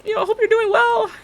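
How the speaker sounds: high, loud, over-bright pleasantries; fake cheerful small talk. Stylist faking familiarity with a stranger, high and overbright. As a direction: cover not knowing her with cheer. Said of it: "And I'm like".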